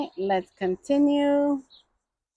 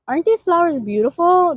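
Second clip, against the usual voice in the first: higher and louder, delighted; excited; 7 semitones higher, 5 dB louder, faster than usual. Real, not acted. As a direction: gush over the flowers. Admiring flowers.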